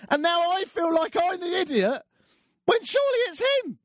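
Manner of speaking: high, squeaky mock whine; whiny squeak. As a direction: play the helpless victim in a tiny high voice.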